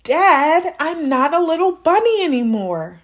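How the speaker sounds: put-on high, loud kid protest; boy correcting his dad; indignant child. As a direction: insist like an offended little boy. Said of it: "said Jasper".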